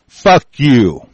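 loud, furious curse; angry shout. Real, not acted. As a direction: shout it at the enemy in real anger. Curses the monument's builders.

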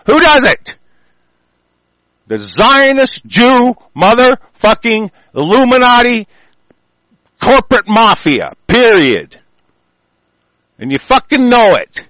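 high and loud accusing rant; angry shouting. Real, not acted. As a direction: bellow the answer as if it's obvious. Ranting, naming who he blames.

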